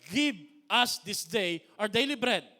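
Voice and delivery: loud, emphatic scripture proclamation; preaching. Acted. Pastor to congregation, loud emphatic proclamation.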